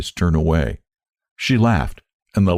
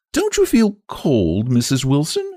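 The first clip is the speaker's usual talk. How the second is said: high, slow and drawn-out like a thin wire; a pointed jab stretched thin and hissing; sly dig, higher and slow; thin, wiry voice; 8 semitones higher than usual. Acted. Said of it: "a voice that seemed drawn out like a thin wire".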